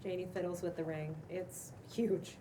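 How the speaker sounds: ordinary talk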